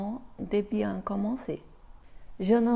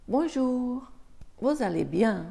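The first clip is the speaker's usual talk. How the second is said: first character; 4 semitones higher than usual. Acted. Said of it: "faire la différence entre les deux personnages".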